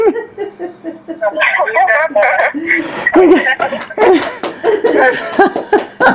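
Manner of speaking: high, drawn-out laughing fit; long giddy laugh. Real, not acted. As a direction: dissolve into a long happy laugh. Delighted at Yeshua's words.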